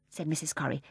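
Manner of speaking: ordinary delivery, nothing marked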